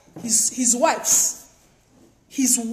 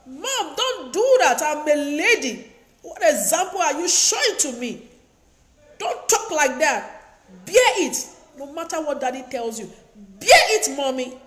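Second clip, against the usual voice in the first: higher, louder, insistent plea to her mother; pleading with mom; 4 semitones higher, 3 dB louder than usual. Retold or imitated. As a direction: beg mom firmly, pitched up and loud. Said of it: "I talk to my mother".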